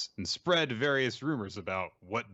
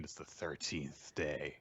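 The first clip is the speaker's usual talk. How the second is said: spooky voice; 6 semitones lower, 8 dB quieter, slower than usual. Pretend. Spooky announcement, low and far.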